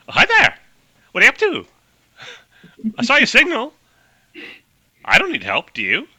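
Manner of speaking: high, bright-pitched aviator greeting strangers; perky high old-timey pilot voice; Amelia Earhart voice. Acted. GM voicing Amelia Earhart greeting the crawler crew, high and chirpy. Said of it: "She's like".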